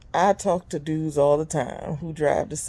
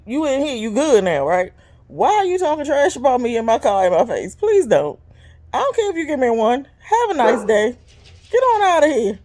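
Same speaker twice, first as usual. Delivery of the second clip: higher, louder mock scolding; sassy telling-off; 10 semitones higher, 7 dB louder than usual. Driver scolds a rude passenger, loud and sassy. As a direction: send the rider off with attitude.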